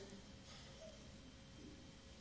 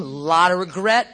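ordinary talk, nothing marked

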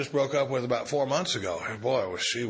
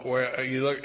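normal talking voice

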